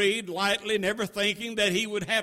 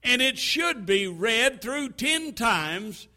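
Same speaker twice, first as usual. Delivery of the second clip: ordinary delivery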